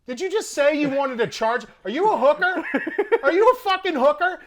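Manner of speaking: high, loud incredulous yell, building; outraged disbelief. Retold or imitated. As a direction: act out an indignant confrontation. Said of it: "I'm pissed".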